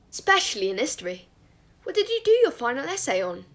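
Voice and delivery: loud, lifted, keen questioning; relentlessly cheerful. A narrator's reading. Christopher quizzing Remus about his history essay, relentlessly cheerful. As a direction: press on brightly, oblivious. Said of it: "relentlessly cheerful".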